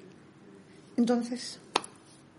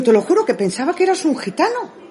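excited telling; 9 semitones higher, 7 dB louder, faster than usual. Retold or imitated.

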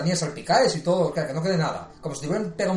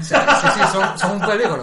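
words through a real laugh, higher and louder; laughing hard at the joke; 3 semitones higher, 8 dB louder than usual. Real, not acted. Joking about divorced people.

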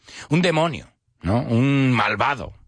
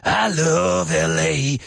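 slow, higher raspy singer's drawl; 4 semitones higher, slower than usual. Retold or imitated. Teacher imitating the singer's famous line, raspy. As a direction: stretch it out with a rasp. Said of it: "he's got a raspy voice".

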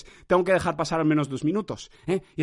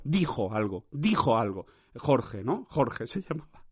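much softer, lower aside; muttered aside; 5 semitones lower, 7 dB quieter, slower than usual. Real, not acted. To himself, recalling the phrase, low and soft. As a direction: drop the voice to an aside.

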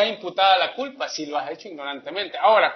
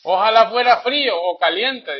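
scripture reading; 3 semitones higher, 4 dB louder than usual. Acted. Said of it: "el Apocalipsis tres quince dice".

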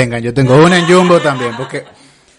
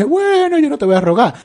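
proud dismissal; 13 semitones higher than usual. Retold or imitated. Proud reply to the guard.

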